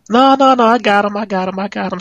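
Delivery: high mocking squeak of his own promise. Host mocking his own defense of his co-host, high mock voice. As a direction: parody yourself way up high.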